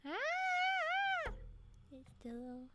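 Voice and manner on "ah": a long, high, wailing cry. Pretend. Streamer wails at chat during ad break, high mock cry. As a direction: let out a high mock wail.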